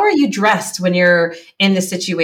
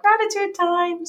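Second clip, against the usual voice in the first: high, slow, drawn-out singsong; sing-song family call; 11 semitones higher, 4 dB quieter, slower than usual. Retold or imitated. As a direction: chime it softly like a teasing reminder. Family imitating her alarm, sing-song.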